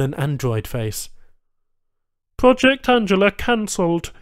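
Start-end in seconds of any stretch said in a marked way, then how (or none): 2.4-4.1 high, loud Banu pronouncement; curt alien tech. A narrator's reading. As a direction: deliver the news flatly. Tech answers tersely.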